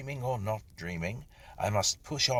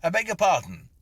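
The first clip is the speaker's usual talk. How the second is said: loud, higher call to a stranger; raised voice; 10 semitones higher, 9 dB louder, faster than usual. A narrator's reading. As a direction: raise your voice so he hears. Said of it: "I said, raising my voice".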